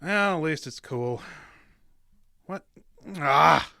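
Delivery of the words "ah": startled yelp. Acted. Elbow stuck in fly paper.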